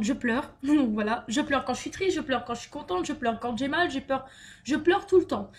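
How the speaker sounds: usual speaking voice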